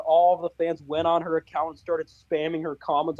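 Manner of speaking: usual speaking voice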